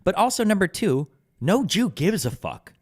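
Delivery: ordinary talk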